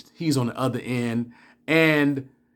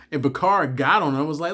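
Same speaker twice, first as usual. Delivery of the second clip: ordinary delivery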